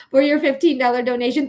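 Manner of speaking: ordinary talk, nothing marked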